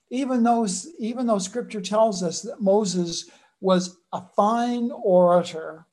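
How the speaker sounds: ordinary delivery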